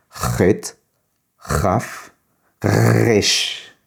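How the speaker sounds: "Chet", "Raf" with a rough, raspy throat sound, then "Resh" with a throaty R; contrasting throat sounds. Shown as a demonstration. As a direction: sound out "Chet, Raf, Resh" slowly, each letter apart, the first two rasped from the throat. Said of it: "faut vraiment réussir à le prononcer différemment". ("Chet", "Raf" raclés dans la gorge, puis "Resh" avec un R guttural)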